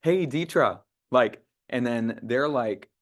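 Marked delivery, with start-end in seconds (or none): 0.0-0.8 higher, bright hello; friendly greeting. Shown as a demonstration. Trainer demos the warm opener to a client. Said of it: "opening it up from the start of like".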